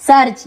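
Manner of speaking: सर्च stressed with an open vowel; EA pronounced as आ. Shown as a demonstration. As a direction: model सर्च clearly and firmly. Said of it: "ई ए का आ उच्चारण हो रहा है". (सर्च में साफ़ आ उच्चारण)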